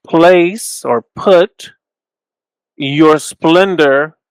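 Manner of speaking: "Place or put" drawn out slowly, rising on "put"; slow emphasis. Shown as a demonstration. Teacher slowing down to stress the alternate reading. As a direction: linger on each word of "Place or put your splendor". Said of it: "Natan can mean put or place".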